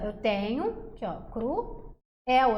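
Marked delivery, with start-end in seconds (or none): 1.3-2.6 "cruel" slowly split, cru... el; separação silábica. Shown as a demonstration. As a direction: pause between the vowels of "cruel". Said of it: "eu tenho duas vogais". ("cruel" devagar, em duas sílabas)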